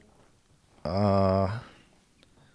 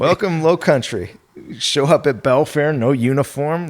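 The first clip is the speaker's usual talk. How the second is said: higher and louder, a lively exclamation; excited; 5 semitones higher, 5 dB louder than usual. Real, not acted. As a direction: punch it out with a grin you can hear. Echoing the host's quip excitedly.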